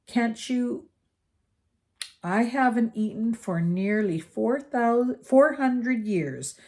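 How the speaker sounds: lingering over his long hunger; lightly voiced old ghost. A narrator's reading. The ghost speaks.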